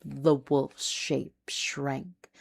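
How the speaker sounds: usual speaking voice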